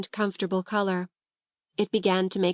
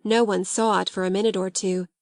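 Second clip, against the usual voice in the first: ordinary delivery, nothing marked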